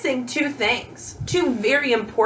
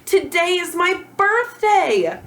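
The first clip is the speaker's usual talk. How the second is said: higher, louder, slower and excited; excited exclamation; 6 semitones higher, 3 dB louder, slower than usual. Shown as a demonstration. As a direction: announce it like joyful news. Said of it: "to show how excited I am".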